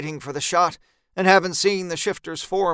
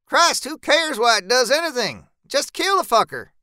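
higher, louder impatient voice; Zeb voiced higher, impatient; 9 semitones higher, 5 dB louder than usual. A narrator's reading. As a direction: push him to shoot, exasperated. Zeb urges the kill.

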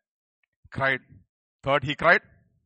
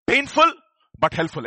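proclaiming; 4 semitones higher, 11 dB louder than usual. Acted. Preacher proclaiming, congregation repeats.

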